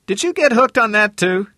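a sharp man asking; Harmon voice. Acted.

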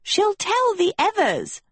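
very high-pitched panicky squeal; high, alarmed character voice. Acted. Villain alarmed at intruder.

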